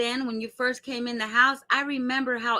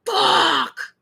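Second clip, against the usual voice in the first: sudden high, louder curse; imitated angry yell; 8 semitones higher, 4 dB louder than usual. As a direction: blurt it out like a raging outburst. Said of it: "you hear him saying".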